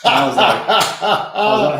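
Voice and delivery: laughing, stretched slow and loud; laughing disbelief. Real, not acted. Reacting to record pace, laughing.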